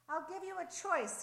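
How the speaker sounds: loud, high proclamation; public announcement. A narrator's reading. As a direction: announce it to everyone gathered below. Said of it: "he announced to the leaders and to the crowds".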